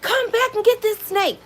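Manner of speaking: high frantic shout for help; mock panicked call for Darnell; panicked yell. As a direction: scream his name across the yard. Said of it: "I went and ran and said".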